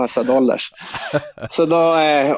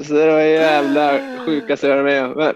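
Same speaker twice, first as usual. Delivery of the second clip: normal talking voice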